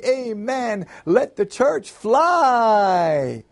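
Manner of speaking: high cry with a very long final word; wildly excited deacon calling out. A narrator's reading.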